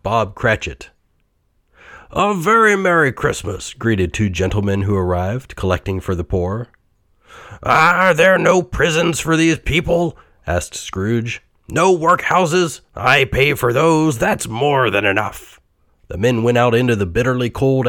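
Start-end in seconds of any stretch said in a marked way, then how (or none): 2.1-3.7 bright, high greeting voice; jolly visitor wishing Merry Christmas; cheery gentleman. A narrator's reading. 7.6-10.2 louder, higher miser's voice; nasty old miser scoffing; gruff Scrooge. A narrator's reading. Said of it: "Scrooge snapped at Bob". 11.7-15.6 louder, higher miser's voice; nasty old miser scoffing; gruff Scrooge. A narrator's reading. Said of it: "Scrooge snapped at Bob".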